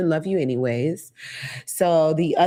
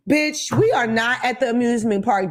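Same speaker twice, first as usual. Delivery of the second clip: normal talking voice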